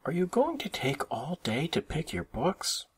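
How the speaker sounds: high, nagging little-sister voice; impatient. A narrator's reading. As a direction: hurry a dawdling brother along. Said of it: "said Sister impatiently".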